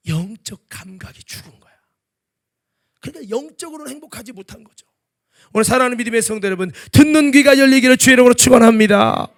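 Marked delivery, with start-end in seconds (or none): 5.5-9.3 loud, fast preacher's blessing; fervent blessing. Real, not acted. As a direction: proclaim it over the church. Benediction at full force.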